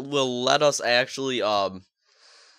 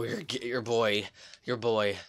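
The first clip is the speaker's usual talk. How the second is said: ordinary delivery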